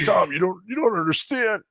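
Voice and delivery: high, choked-up insisting; high tearful insistence; emotional plea. Retold or imitated. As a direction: make him feel it. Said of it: "I was like".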